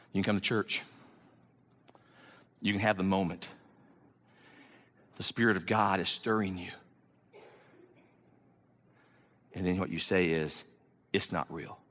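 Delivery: low, hushed, deliberate warning with long pauses; soft, low pulpit gravity; solemn preaching. Real, not acted. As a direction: bring it down low and quiet, let each phrase land.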